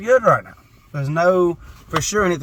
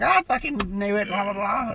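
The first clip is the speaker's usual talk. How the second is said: mom's retort; 7 semitones higher, slower than usual. Retold or imitated. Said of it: "And she was like".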